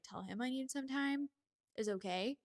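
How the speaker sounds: ordinary talk, nothing marked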